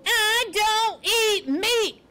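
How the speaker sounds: loud, high, slow drawn-out bellow. A narrator's reading. Porcupine bellowing into Poppy's face, loud and slow. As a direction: bellow each word separately, high and slow. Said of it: "Aerith bellowed into Poppy's face".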